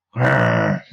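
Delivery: low rolling "grrr" growl; angry growl. Acted. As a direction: growl with loathing through a long rolled r. Monk snarls at his rival.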